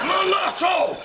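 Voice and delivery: high loud shouted retort; son's shout. Retold or imitated. Son yelling back at his father, high and loud. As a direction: bellow it back defiantly.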